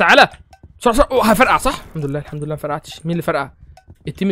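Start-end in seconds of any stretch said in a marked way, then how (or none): 0.0-1.8 loud, higher panicked yelling; excited shout. Real, not acted. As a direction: shout it in a rush as the danger hits. Tense moment, about to explode. (صراخ متحمس ومتوتر)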